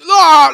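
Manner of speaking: sudden high yell of someone wrecked on a bike; retold scream. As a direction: let out a loud strained scream. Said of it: "like, screaming".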